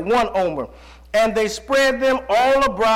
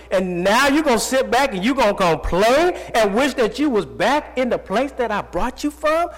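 usual speaking voice

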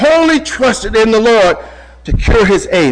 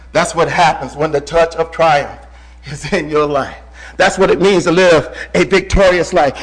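ordinary talk, nothing marked

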